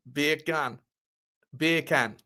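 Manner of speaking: put-on Jamaican accent on two short words. Streamer answering an accent request, mock Jamaican accent. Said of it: "Jamaican accent, isn't it?".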